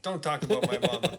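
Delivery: playful, quick mock warning with some volume; mock threat. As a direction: toss out a jokey warning, loud and quick. Joking rehearsal of threat.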